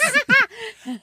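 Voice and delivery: high cackling witch laugh. Acted. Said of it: "Was that a good witch laugh?".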